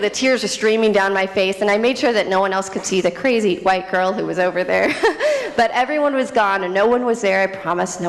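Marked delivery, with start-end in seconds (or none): none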